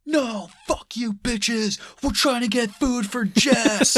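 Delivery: high, slow whiny defiant yell; mocking Spencer Breslin's high defiant yell; mock whiny teen. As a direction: shout like a petulant kid. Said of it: "Spencer Breslin's just like".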